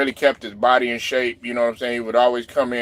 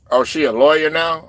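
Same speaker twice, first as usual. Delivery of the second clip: normal talking voice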